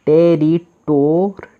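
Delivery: teacher sounding out the word; slow blended syllables. Shown as a demonstration. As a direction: stretch every syllable. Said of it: "तो आर का र".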